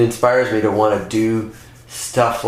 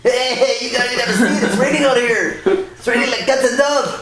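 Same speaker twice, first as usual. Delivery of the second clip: much higher put-on cartoon voice; high-pitched comic character voice; silly high voice; 13 semitones higher than usual. Demoing a learned comic voice.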